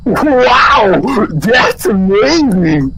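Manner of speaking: loud, high, tearful amazement; amazed crying shout. Acted. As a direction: wail out your wonder. Repeat of amazed line, crying.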